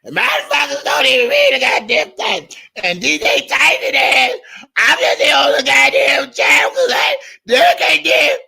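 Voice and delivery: high, screechy mocking old woman; mock the rival lady's screechy voice. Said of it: "that old screechy, scratchy ass voice".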